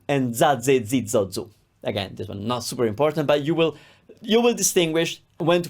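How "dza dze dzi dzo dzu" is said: "za", "ze", "zi" each begun with a voiced dz; DZ syllable drill. Shown as a demonstration. Teacher drilling the second Z sound, "za" as "dza". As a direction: buzz a d plus z before each vowel, "za" to "zu". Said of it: "So it's ts and z".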